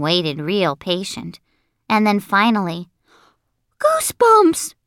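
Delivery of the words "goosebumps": very high, loud squeal; high-pitched cry of discovery; excited boy's shout. A narrator's reading. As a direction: yell it out like an overexcited kid. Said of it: "shouted Sheldon".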